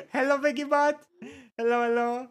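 high, giggly hello; laughing greeting. Real, not acted. Streamer greets a chatter after translator audio, laughing. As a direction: greet them through laughter.

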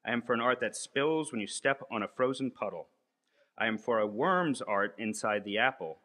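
emphatic, measured poem-like reading; incantatory list, each "I am for" launched anew; manifesto recitation. Acted. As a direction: declaim it like an invocation, pausing between phrases.

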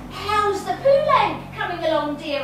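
loud, high, overly casual enquiry; feigned normality. Retold or imitated. Customer in the bookies making small talk, feigned normality. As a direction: ask as if nothing is out of the ordinary. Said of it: "as if everything was normal".

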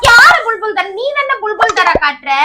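high, loud challenge; angry retort. Real, not acted. Accused girlfriend throws her boyfriend's taunt back, angrily. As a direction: fling the taunt back at him, heated.

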